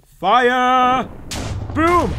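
loud, high, drawn-out battle cry; playful pirate yell; shouted firing order. Pretend. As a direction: shout the cannon command with glee. Pirate calls cannon fire.

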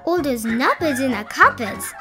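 ordinary delivery, nothing marked

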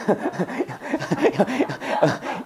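helpless, soft giggling; long amused laughing fit. Real, not acted. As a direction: break up laughing at own pun. Laughs over the Insu pun. (말장난에 한참 터진 웃음)

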